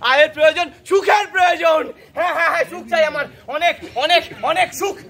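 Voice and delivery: worked-up, ranting insistence; fervent declaration. Acted. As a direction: build greedily to a big cry. Proclaims craving for income and happiness.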